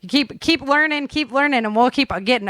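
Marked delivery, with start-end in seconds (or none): none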